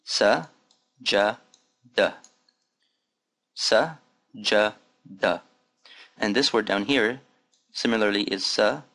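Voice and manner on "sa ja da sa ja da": sa ja da said slowly, one by one; teacher syllable drill. Shown as a demonstration. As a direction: break sajada into sa, ja, da. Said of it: "Now repeat after me".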